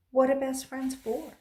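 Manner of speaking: ordinary delivery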